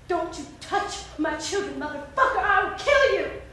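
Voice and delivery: slow, deliberate threat, building at the end; menacing warning. Acted. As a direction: warn him off word by word. Mother warns him off her children.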